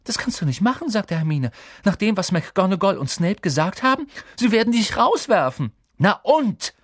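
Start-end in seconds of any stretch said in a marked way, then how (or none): 0.0-0.9 put-on high-pitched, aghast girl's voice; shocked pleading; alarmed protest. A narrator's reading. Friend protests the reckless plan. 1.8-5.7 put-on high, urgent girl's voice; aghast, pitched well up; alarmed warning. A narrator's reading. Friend warns he will be expelled. 6.0-6.7 loud, high retort; cried out, exasperated; defiant shout. A narrator's reading. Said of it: "rief Harry".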